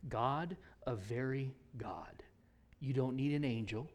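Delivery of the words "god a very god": slow, hushed whisper; soft slow reverence; reverent whisper. Acted. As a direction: whisper it with awe, slowly. Reverent aside.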